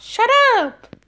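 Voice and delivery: high, squeaky mock of a sibling. Kid mocking brother's voice, squeaky high. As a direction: squeak it back to tease. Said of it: "That's how you sound".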